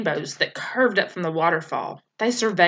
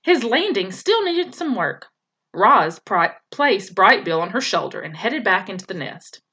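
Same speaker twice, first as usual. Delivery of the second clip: ordinary delivery, nothing marked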